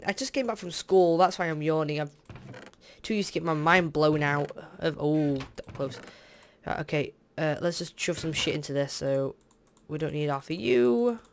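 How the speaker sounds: ordinary talk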